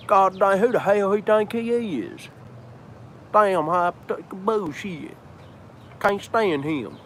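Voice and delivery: put-on high, cranky old guy grumbling; grumpy old man voice. Grumpy neighbor voice about priest hat.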